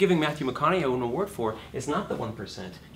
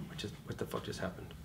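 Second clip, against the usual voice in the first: whispered, much softer, dazed; stunned whisper; 5 semitones lower, 15 dB quieter than usual. Retold or imitated. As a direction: breathe it out in disbelief. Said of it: "you go".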